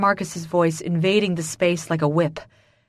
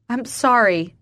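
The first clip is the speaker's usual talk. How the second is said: higher, louder plea; distressed, raised-voice apology; crying out; 5 semitones higher, 5 dB louder, slower than usual. A narrator's reading. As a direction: cry out the apology. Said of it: "I cry".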